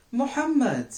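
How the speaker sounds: high, loud stern call of a name; a mother finding the mess. Acted. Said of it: "she began in a louder than usual voice".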